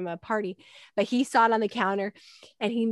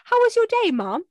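put-on much higher, louder, sweet polite child; polite boy; 4 semitones higher, 6 dB louder than usual. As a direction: play the perfect polite son. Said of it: "he's the one that's like".

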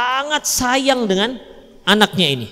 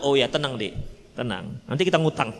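much lower, softer reassurance; calm soothing; 7 semitones lower, 7 dB quieter than usual. Pretend. Husband calmly reassuring his wife about fees, soft and easy. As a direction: settle her down, no fuss.

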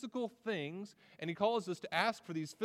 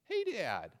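put-on eager little boy; 4 semitones higher than usual. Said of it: "he begins every question with the phrase".

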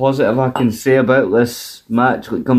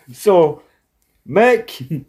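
ordinary delivery

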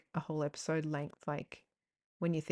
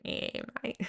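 Bogan; 6 semitones higher, 4 dB quieter than usual. Retold or imitated. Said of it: "Like, Bogan".

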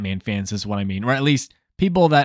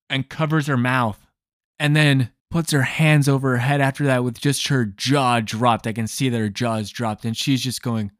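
ordinary talk

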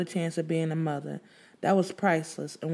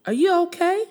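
worried neighbor; 12 semitones higher, 5 dB louder than usual. Acted.

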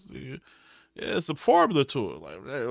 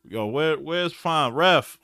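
ordinary talk

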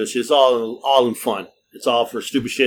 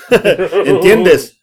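high, loud laughing ask; laughing question; 6 semitones higher, 8 dB louder than usual. Retold or imitated. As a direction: ask through a laugh, amazed. Woman surprised he understands.

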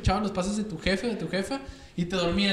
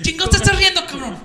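loud, high, furious father's yell; father yelling at his son for laughing during the spanking; angry dad; 12 semitones higher, 10 dB louder than usual. Retold or imitated. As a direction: blow up at the giggling kid.

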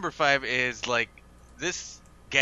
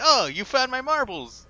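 high, loud, drawn-out mock delight; delighted exclamation; 12 semitones higher, 6 dB louder than usual. Retelling an old man getting his marbles back, mock delighted. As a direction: exclaim it in a high, overjoyed imitation.

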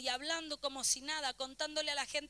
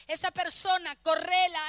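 loud, punched order; forceful command; 7 dB louder than usual. Acted. God ordering the believer to push someone away, forceful command. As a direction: order it out loudly and firmly. Preacher voicing God's command.

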